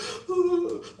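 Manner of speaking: a sharp, high gasp of being moved; high emotional gasp. Retold or imitated. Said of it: "I was like".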